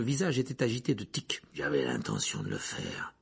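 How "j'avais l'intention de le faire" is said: very low, menacing growl. A narrator's reading. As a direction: drop deep and growl it. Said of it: "grogna-t-il en découvrant ses dents jaunes".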